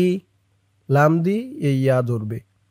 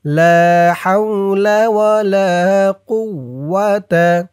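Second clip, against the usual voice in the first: slow, louder chanted recitation; 3 semitones higher, 3 dB louder, much slower than usual. Shown as a demonstration. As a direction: draw out লা হাওলা with a full voice. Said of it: "আমি যেমনভাবে উচ্চারণ করব".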